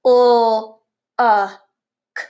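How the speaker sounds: O, a, k as three separate sounds, held l first; sounded out. Shown as a demonstration. Teacher leading children to try a word with her, sounded out. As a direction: draw out O as an l sound, then short a, then hard k. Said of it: "try these ones with me".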